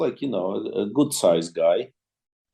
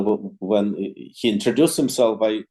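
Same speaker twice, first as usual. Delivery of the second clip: ordinary talk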